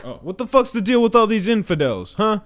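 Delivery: hammed-up zealot voice, swaggering and gleeful; brash jihadi caricature.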